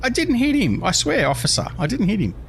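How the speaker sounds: high pleading denial, settling back down; mock innocent protest. To an imagined officer, a hammed-up high plea of innocence. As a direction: protest innocence like a caught kid.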